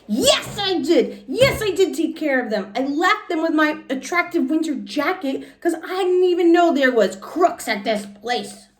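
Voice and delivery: put-on high, insistent child's protest; indignant protest. Junie B. insisting to Missus she took care of them. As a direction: stamp your foot and insist.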